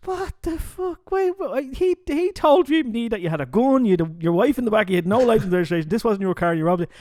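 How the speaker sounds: high, loud disbelieving sergeant; a baffled sergeant yelling; shocked outburst. Acted. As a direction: blurt it out in stunned disbelief.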